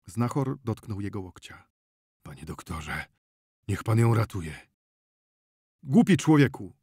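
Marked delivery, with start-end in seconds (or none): 2.2-4.7 grave, imploring voice; subdued plea. Acted. Healer begs the doctor again. 5.8-6.7 loud, higher-pitched exasperation; scornful, exasperated rebuke; irritated. A narrator's reading. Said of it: "odwrócił się doń z irytacją".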